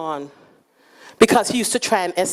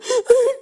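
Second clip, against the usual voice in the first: alarmed gasp. Retold or imitated. Daughter gasping in alarm.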